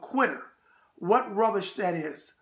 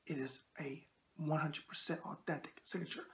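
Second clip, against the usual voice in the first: whisper; 3 semitones lower, 11 dB quieter than usual. Acted. Confiding the autograph is real.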